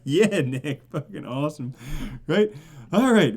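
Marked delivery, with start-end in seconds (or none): none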